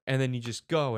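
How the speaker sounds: usual speaking voice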